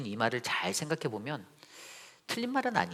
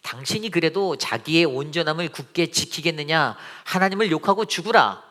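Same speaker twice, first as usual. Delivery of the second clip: raised, forceful scripture quote; Job's wife; 4 semitones higher, 7 dB louder than usual. Acted. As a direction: build to a loud, high command. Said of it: "그의 아내가 그에게 이르되".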